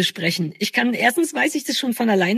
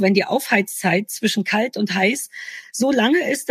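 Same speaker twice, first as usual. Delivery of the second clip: ordinary talk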